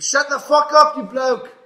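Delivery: furious full-volume shout, pitched high; screamed outburst. Retold or imitated. Guest retelling his outburst at the rapper, screamed. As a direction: scream it loudly at someone to shut them up. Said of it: "I just screamed".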